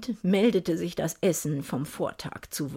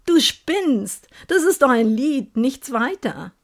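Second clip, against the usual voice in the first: disbelief; 5 semitones higher, 5 dB louder than usual. Acted. Disbelieving outburst at boyfriend.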